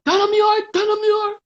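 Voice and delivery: high loud call of a name across a room. Retold or imitated. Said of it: "she just said".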